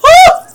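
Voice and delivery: sudden high shriek of fright; startled scream. Real, not acted. Player caught off guard by the monster, startled shriek. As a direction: jump out of your skin and shriek.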